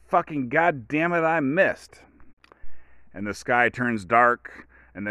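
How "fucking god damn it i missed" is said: angry curse. Retold or imitated. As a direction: blow up at the missed shot, hammed-up. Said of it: "And he goes".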